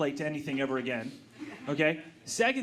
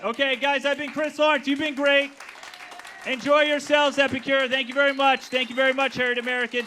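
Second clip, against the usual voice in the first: sign-off; 8 semitones higher, 7 dB louder than usual. Real, not acted. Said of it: "sign-off to audience".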